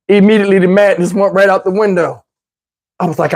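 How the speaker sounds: usual speaking voice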